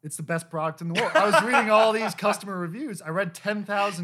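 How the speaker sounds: high, loud and laughing. Real, not acted. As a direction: laugh it out, giddy. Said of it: "I got brainwashed by Peloton".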